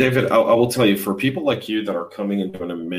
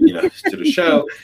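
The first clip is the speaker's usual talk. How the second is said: high laugh through the words; 11 semitones higher, 4 dB louder than usual. Real, not acted. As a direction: break into a laugh mid-quote. Laughs while quoting applicant.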